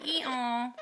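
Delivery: loud, high imitated hee-haw; donkey bray. Shown as a demonstration. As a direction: do a donkey for the parrot to copy. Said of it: "Now do donkey".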